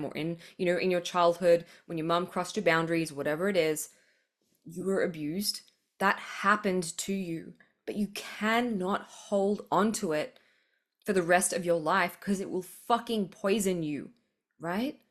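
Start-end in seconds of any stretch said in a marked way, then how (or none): 4.6-10.4 slow whisper that soothes; tender whisper. Real, not acted. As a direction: breathe it gently to someone hurting. Tender whisper to abused listener.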